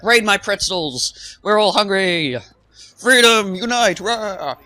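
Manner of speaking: high, loud mock war cry; rallying battle cry. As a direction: rouse the class like a rebel leader. Rallying hungry classmates to pretzels.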